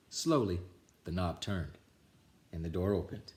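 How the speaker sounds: ordinary delivery, nothing marked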